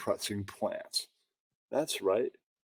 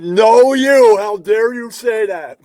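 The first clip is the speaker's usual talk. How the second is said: loud indignant cry, pitched up; mock outraged shout; 10 semitones higher, 13 dB louder than usual. Streamer mocking an offended fan, loud outraged shout. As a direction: yell it like you're shocked and offended. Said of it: "And I've went".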